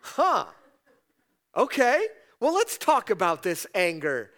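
God faking horror at honest anger; mock shock. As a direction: pretend to be scandalised, tongue in cheek. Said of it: "He doesn't go".

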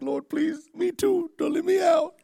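very high, small begging voice; pleading prayer. Retold or imitated. Preacher begging God in his hotel room, pleading. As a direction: plead like a child afraid of being left out. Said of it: "I'm like".

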